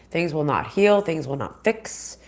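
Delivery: usual speaking voice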